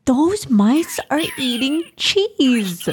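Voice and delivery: high, slow, drawn-out exclamation; exclaiming. A narrator's reading. Noor to Ibrahim on spotting mice, exclaiming. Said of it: "Noor exclaimed".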